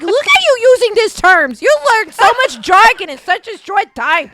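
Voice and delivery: high, loud, teasing astonishment; mock amazement. Wife amazed at husband's new jargon, loudly teasing.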